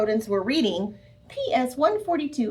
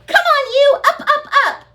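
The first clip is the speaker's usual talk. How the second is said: very high loud shouts; yelling; 8 dB louder than usual. Acted. Camilla shouting at leaves, very high and loud. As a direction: shout the leaf awake.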